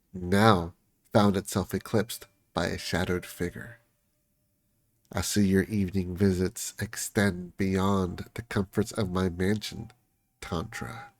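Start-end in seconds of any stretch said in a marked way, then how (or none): 5.1-11.1 quiet, menacing, ending in a whisper; sinister, fading to a hiss on the name; menacing villain hush. Acted. Shadowed figure catching the lovers, menacing whisper.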